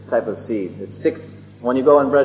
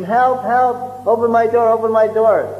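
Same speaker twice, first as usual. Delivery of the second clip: mock yell for help; 8 semitones higher than usual. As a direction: play a locked-in man yelling at his door. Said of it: "the person would yell and scream".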